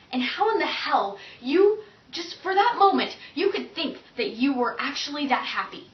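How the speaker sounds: performed heartbreak boiling over into incredulity; acted exasperated heartache. Monologue about unrequited love at its climax, exasperated and building.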